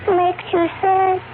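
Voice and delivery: normal talking voice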